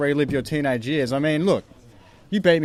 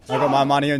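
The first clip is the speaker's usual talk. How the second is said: ordinary talk, nothing marked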